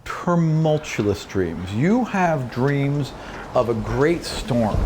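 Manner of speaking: ordinary talk, nothing marked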